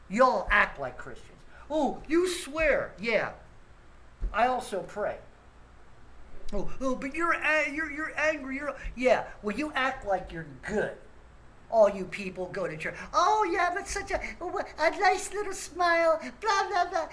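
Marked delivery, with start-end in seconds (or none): 1.7-2.9 high, slow sneering mimicry of a scolding churchgoer; mocking churchgoer. Mocking Christians' reproach. 6.5-8.7 higher, put-on prissy voice; mocking churchgoer. Mocking Christians' reproach. 13.1-17.1 high, sugary put-on churchgoer voice; mocking fake niceness.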